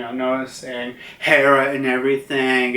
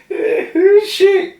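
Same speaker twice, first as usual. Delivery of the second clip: very high, slow cartoon whine; hammed-up pitiful squeak; whimpering cloud; 5 dB louder, slower than usual. Zeus asks about the newcomer, pitiful whine. Voices tearful Zeus, jealous.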